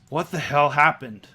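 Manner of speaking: loud, shocked demand; shocked outburst. Acted. Istrid finding her dead friends, shouting in shock. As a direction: burst out at the sight of the bodies.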